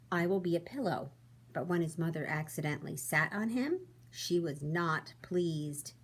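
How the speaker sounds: storybook read-aloud, expressive and even; reading to children. A narrator's reading. Teacher reading to her class, storybook voice. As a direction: read it like bedtime story time.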